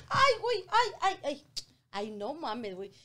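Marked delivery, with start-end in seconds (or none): 0.1-1.5 imitating her friend's pained ay ay ay; mock pain cries. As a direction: act out her friend wincing. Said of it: "cuando se sienta".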